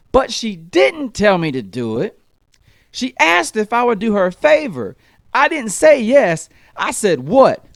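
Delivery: put-on a four-year-old explaining a loophole; smug kid logic. Daughter argues the technicality.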